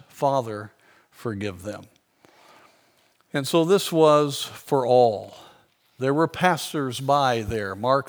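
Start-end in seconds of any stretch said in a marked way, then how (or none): 0.1-1.8 much softer, lower father, forgive them; softly, with awe. Retold or imitated. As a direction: breathe the words, lower and quiet. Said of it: "amazing that he said this".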